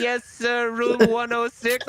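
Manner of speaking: high, slow, overly polite clerk voice; disguised caller voice. Retold or imitated. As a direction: spell out the room number like a prim clerk. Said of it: "such a good job disguising it".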